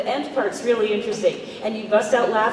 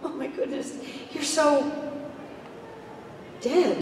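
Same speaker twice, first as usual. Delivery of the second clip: hushed, halting shock; corrected line reading; 5 semitones higher, 7 dB quieter than usual. Shown as a demonstration. Actress redoes the line for a death scene, hushed and halting. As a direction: trail off softly before the last word. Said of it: "the wrong line reading".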